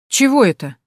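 affronted little outburst; indignant. A narrator's reading. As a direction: object sharply, taken aback. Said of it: "возмутилась я".

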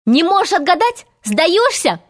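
put-on high, gleeful boyish taunting; delighted yell. A narrator's reading. Peter thinks he is winning, delighted cry. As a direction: ask in rising delight. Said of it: "в восторге завопил Питер".

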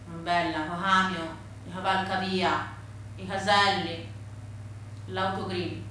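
low, soft, heavy telling; subdued sorrow. A narrator's reading. Granddaughter tells of motorway built through grandfather's land, subdued. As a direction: let it sink low and quiet, grieving.

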